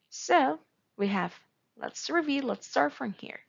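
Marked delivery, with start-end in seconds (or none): none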